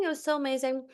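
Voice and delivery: high and thrilled; excited. Real, not acted. Gushing to listeners, thrilled and high. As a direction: squeal it with delight.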